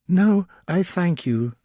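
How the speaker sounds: Elizabeth declining. A narrator's reading. Elizabeth politely refusing Darcy's help, slow. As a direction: decline gently, holding composure. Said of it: "she replied".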